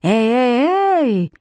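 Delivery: put-on drawn-out boyish holler, rising high; mild shout from across a hall; calling out. A narrator's reading. Boy interrupting from the far end, calling out. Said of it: "заорал Коннор из дальнего конца зала".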